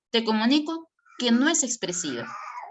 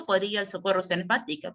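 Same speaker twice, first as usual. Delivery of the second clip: normal talking voice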